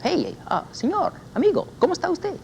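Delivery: greeting someone in the street, high and cheerful; friendly street greeting. Acted. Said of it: "I say to you".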